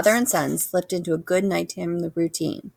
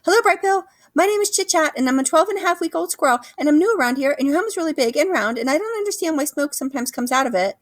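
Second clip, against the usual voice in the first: very high, rapid squirrel chatter; a squirrel pouring out her words to a new friend; 9 semitones higher, faster than usual. A narrator's reading. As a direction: rattle it off like an excitable young squirrel.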